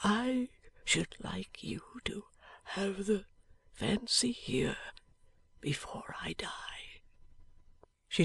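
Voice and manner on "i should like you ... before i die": barely voiced, dying breath; a dying man's faint whisper. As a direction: whisper it weakly from a sickbed. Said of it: "said Eugene quietly".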